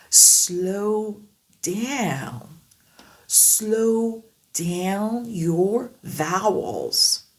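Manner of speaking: Slow down your vowels, very slow; phrase anglaise très lente; slow drill sentence. Shown as a demonstration. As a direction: stretch each vowel slowly. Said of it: "Essayez maintenant dans une phrase".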